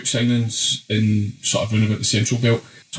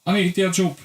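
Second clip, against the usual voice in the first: mock heckle; 7 semitones higher, much faster than usual. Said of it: "gave him going".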